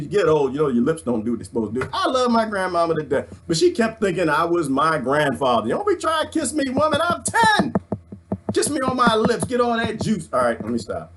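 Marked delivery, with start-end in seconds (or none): 5.7-10.3 put-on high-pitched kid voice pushing granny away; mock panicked little boy. Ten-year-old grandson pleading with his grandmother, high squeaky protest.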